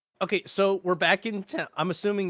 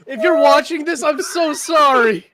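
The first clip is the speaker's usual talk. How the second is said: loud, higher mock apology; excited; 7 semitones higher, 9 dB louder than usual. Real, not acted. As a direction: gush an apology loudly. Mock apology to absent friend.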